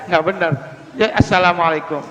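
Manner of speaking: ordinary talk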